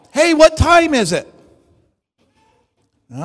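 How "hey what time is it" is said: retold yell. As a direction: call out higher and louder. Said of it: "then they yell at me".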